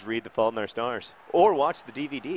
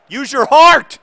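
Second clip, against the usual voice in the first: rising to a loud high yell; angry yelling; 18 dB louder, slower than usual. Real, not acted. Coach on the sideline screaming at the boys, loud and high. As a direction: bellow at the players from the sideline. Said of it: "I got angry".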